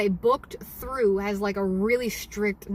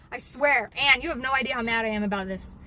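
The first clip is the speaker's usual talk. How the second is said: ordinary talk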